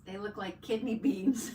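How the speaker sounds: words broken by real laughter; laughing. Real, not acted. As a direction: say it while cracking up at the beans. Laughing at the bean emojis.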